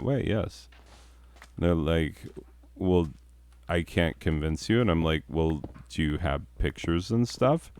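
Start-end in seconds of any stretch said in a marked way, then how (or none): none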